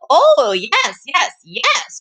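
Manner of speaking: high, eager fake yes; eager dog cry. Mister Dog faking Salma's voice to Granny, high and eager. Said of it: "cried Mister Dog".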